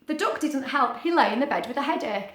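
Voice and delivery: ordinary delivery, nothing marked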